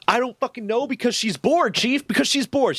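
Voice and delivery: high-pitched exasperated outburst. Retold or imitated. Airman venting to his Navy chief, exasperated. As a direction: vent it, at wit's end. Complains to chief about sergeant.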